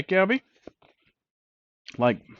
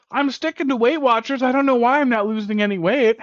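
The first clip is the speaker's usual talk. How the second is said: mock dieter; 10 semitones higher, 5 dB louder than usual. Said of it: "and then the next day you say".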